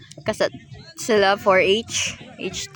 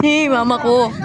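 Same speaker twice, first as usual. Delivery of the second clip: ordinary delivery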